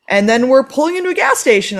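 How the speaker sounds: normal talking voice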